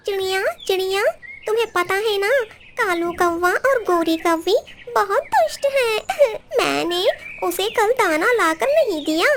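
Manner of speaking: high, sobbing complaint; tearful parrot. Acted. Beaten parrot tells the sparrow about the crows, high and tearful.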